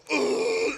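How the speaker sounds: a short grunting machine noise; machine groan. Retold or imitated. Imitating the coffee machine, a grunted noise. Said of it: "a machine that goes".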